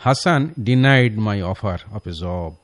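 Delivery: slow, careful wrong sentence; slow wrong example. Shown as a demonstration. Teacher reading the wrong sentence slowly to students. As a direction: read it slowly before correcting it. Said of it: "এটা একটু ভুল sentence".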